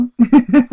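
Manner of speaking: quick high laugh at her own joke; a bright burst of laughter; short laugh. Real, not acted. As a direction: laugh off the teasing. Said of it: "Non, je rigole".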